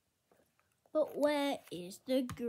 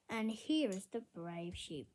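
ordinary talk

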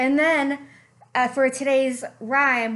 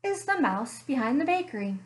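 softer, storytelling read-aloud voice; story narration; 4 semitones lower, 3 dB quieter than usual. A narrator's reading. Librarian reading a bear story to children, gentle storytelling. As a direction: draw the kids into a cozy mystery tale.

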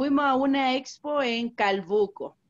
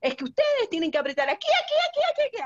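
impatient landlady; 9 semitones higher, faster than usual. Retold or imitated. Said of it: "nos dijo así".

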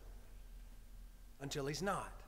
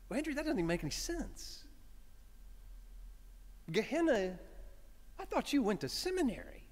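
a hint of a scoffing Jesus, a touch higher; lightly voiced Jesus; 5 semitones higher than usual. Jesus correcting the preacher about Gehenna, lightly scoffing. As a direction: set him straight, half amused.

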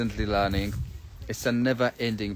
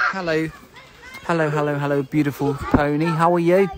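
higher, louder sing-song hellos; cooing greeting; 5 semitones higher, 7 dB louder than usual. Acted. Scene: vlogger greeting a beautiful pony, cooing. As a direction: greet the pony like a delighted child.

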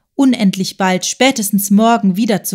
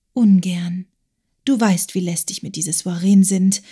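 hushed and reluctant; whisper. Acted. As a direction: murmur it unwillingly, close to his ear. Agathe reluctant, pleading.

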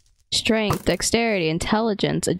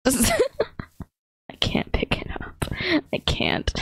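laughing whisper; 9 semitones higher, much slower than usual. Real, not acted. Struggling laughing whisper in game.

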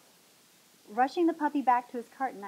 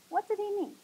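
ordinary delivery

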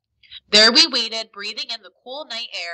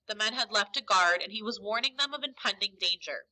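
plain reading voice

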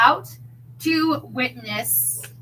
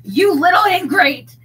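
loud, higher angry shout; 5 semitones higher, 10 dB louder than usual. Acted. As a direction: bellow it at the ungrateful client. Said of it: "he shouted".

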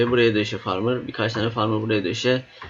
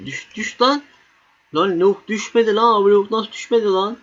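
excited yelling at the screen; 9 semitones higher than usual. Real, not acted. Yelling at enemy unit to fall.

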